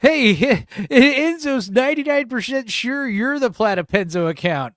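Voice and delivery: high loud mock voice; imitating voice. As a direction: ham up a friend's voice. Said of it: "And he's like".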